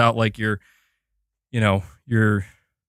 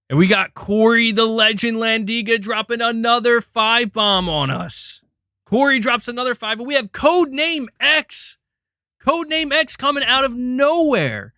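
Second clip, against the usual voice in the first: excited hype; 8 semitones higher than usual. Real, not acted. Hyping gift memberships.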